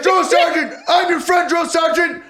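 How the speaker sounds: very high, loud shouted replies; high mock-frantic shouting; privates shouting back. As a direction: yell it like panicked privates scrambling to answer. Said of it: "And you're like".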